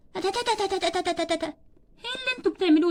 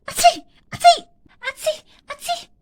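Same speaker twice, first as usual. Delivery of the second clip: loud acted sneezes, high; sick child sneezing in a play; mock sneezes; 9 semitones higher, 6 dB louder than usual. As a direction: sneeze twice, theatrically.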